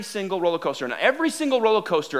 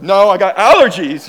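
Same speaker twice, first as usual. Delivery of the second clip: loud, blustering excuse; defensive denial; 12 dB louder than usual. Retold or imitated. Tearful rider denying crying to the ride girl, loud defensive denial. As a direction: blurt it out loudly to cover up the tears.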